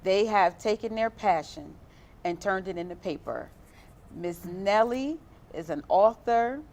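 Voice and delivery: loud, upbeat host welcome back; show host intro. Acted. Host returns from the clip.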